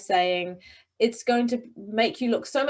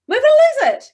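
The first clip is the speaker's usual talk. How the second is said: much higher, much louder excited burst; 12 dB louder than usual. Shown as a demonstration. Coach contrasting an excited version with the forceful one. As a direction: shout it with big excitement. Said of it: "compared to".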